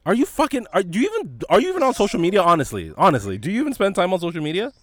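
loud, high disbelief that builds; can't believe she's never heard of it; incredulous exasperation. Real, not acted. Friend doesn't know the trend.

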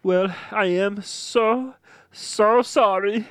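put-on a young man's shaky farewell video, held together; grieving streamer. Jason tells his followers Liam is dead, tearful. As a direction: keep it together through the tears.

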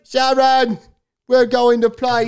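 high-pitched mock British rocker. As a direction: impersonate a rambling rock star calling his wife. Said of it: "[name]. The Prince of Darkness".

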